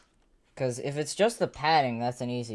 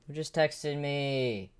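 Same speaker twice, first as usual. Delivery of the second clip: ordinary talk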